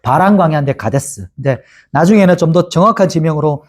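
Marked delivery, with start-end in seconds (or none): none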